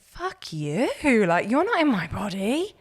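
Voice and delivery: indignant outburst, re-lived; retold anger. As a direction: fire back at the doctor with indignation. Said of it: "and I was so angry".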